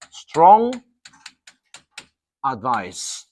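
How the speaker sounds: emphatic strong advice; stress on strong. Shown as a demonstration. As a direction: hit the word strong hard. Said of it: "teacher stresses strong".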